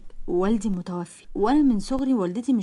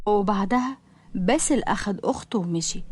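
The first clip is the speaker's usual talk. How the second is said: plain reading voice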